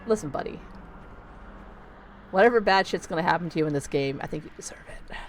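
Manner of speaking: usual speaking voice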